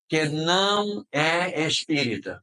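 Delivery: NÃO drawn out, each word slow and deliberate; weighty, stretched-out NÃO; emphatic stress on NÃO. Real, not acted. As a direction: slowly underline "não é espírita". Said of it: "Vocês ouviram o que eu disse?".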